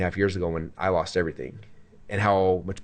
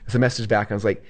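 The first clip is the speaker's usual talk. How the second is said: ordinary talk, nothing marked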